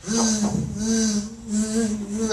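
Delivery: long vocal zzz of a bee; buzzing bee. Retold or imitated. Bee interrupts man praying, imitated buzzing. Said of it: "this fucking bee just starts".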